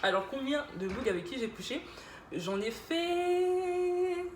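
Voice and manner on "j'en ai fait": high, held last word like a drumroll; drawn-out suspense. Pretend. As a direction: stretch it out teasingly before the answer. Teasing suspense before giving the number.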